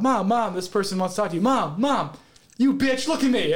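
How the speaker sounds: high, insistent call across a room. Retold or imitated. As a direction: re-enact calling for mom's attention. Retells calling out to mom.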